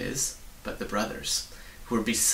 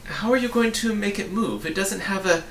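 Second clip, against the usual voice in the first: high, louder questioning voice; puzzled boy; 9 semitones higher, 5 dB louder than usual. A narrator's reading. Milo to the cabinet about the wagon, high-pitched and puzzled. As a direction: ask it high and insistently.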